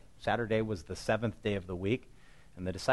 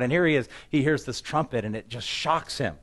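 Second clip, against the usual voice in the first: usual speaking voice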